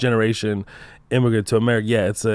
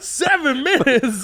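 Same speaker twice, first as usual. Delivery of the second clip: high, drawn-out squeal of disbelief; exasperated retold outcry; 13 semitones higher, much slower than usual. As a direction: wail it out in mock outrage, dragging it. Retelling Uber meltdown.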